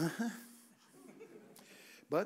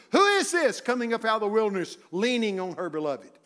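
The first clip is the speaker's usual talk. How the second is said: higher, louder declaimed verse; loud scripture proclamation; 5 semitones higher, 8 dB louder than usual. Acted. Preacher proclaiming Song of Solomon, loud declaiming. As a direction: ring the verse out to the church.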